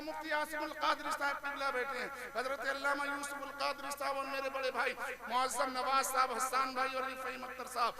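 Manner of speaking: usual speaking voice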